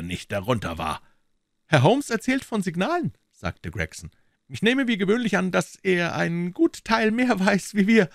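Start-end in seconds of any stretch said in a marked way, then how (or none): 1.7-3.1 very high character voice; police detective reporting. Acted. Detective tells the American about signals, high voice. 4.5-8.1 high character voice, slowing late; police detective conceding. Acted. Detective admits Holmes knows more, high conceding voice.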